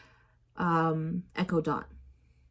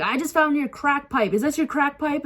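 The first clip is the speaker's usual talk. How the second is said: higher-pitched mock yell; retold yelling; 7 semitones higher than usual. Roommate accusing her over a pipe, mock yelling. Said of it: "he starts yelling at me".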